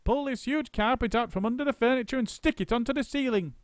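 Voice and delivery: high bossy monkey. A narrator's reading. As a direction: hurry the crew along. Mugglewump's orders.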